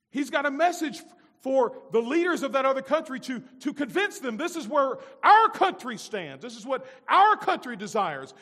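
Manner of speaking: loud, driving preacher's delivery; full-force preaching. Acted. Preacher rousing his congregation, in a loud preaching voice.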